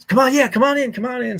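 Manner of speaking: high-pitched, hurried mock invitation; urgent ushering in. Buddy hustling visitor off his porch, flustered high-pitched ushering.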